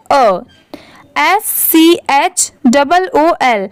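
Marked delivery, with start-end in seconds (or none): 0.1-0.4 "अ" as a short schwa, uh; letter A read as "अ". Shown as a demonstration. Teacher showing learners how to read the article, short "अ". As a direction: say "अ" short, not ay. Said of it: "हम लोग क्या पढ़ते हैं?".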